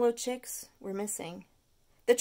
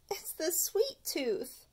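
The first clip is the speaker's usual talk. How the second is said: slow, drawn-out excuse, higher; Stuart's refrain; 7 semitones higher, slower than usual. Acted. Stuart blaming his sweet tooth, slow and whiny. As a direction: blame it on the tooth, slowly.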